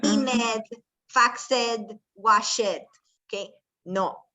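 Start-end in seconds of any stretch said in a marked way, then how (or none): 0.0-2.9 "cleaned, faxed, washed" with a full -ed syllable, clean-ed, fax-ed, wash-ed; mispronounced -ed endings. Shown as a demonstration. Teacher shows class the common error, "cleaned, faxed, washed" with -ed as extra syllable. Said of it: "Muchos de nosotros decimos".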